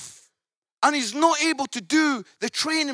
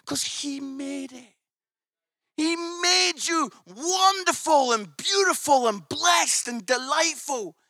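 full-force preaching; 4 semitones higher, 5 dB louder than usual. Acted. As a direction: preach it big and stirring.

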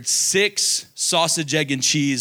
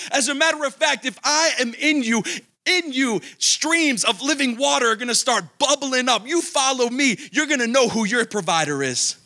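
rising, higher-pitched sermon build, fervent; preaching; 6 semitones higher than usual. Acted. Pastor tells young believers Jesus is the bread of life, fervent higher preaching. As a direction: preach it up to the congregation, swelling with conviction.

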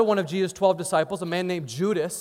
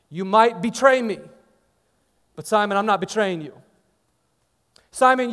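building, louder preaching; fervent preaching; 3 semitones higher, 4 dB louder than usual. Acted. Preacher voicing Jesus' promise to Peter, fervent and building. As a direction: press the promise home to the congregation.

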